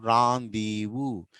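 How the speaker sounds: రాందీవు slowly as "raan-dee-voo"; teacher's drawn-out model of రాందీవు; correct pronunciation. Shown as a demonstration. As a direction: stretch all three syllables of రాందీవు. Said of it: "రెండేస్ బస్ కాదు".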